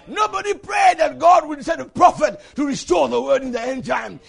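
loud, high preacher's proclamation; full-force preaching. Real, not acted. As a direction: drive it home to the congregation, stretching the key words.

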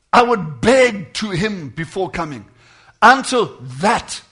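slow, swelling plea; pleading. Retold or imitated. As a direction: drag the words out as if imploring. Prophet's begging quoted again.

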